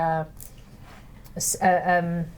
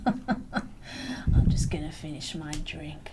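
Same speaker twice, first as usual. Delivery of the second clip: slow, soft, lower-pitched reply; subdued; 4 semitones lower, 7 dB quieter, slower than usual. Acted. Catfish returning to his drink after the insults, subdued. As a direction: back off quietly and take your time over it.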